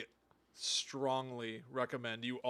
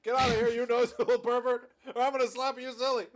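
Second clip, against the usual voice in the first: high, loud comic scolding; hammed-up indignant shout; mock angry threat; 10 semitones higher, 10 dB louder than usual. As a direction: holler it like an outraged crook.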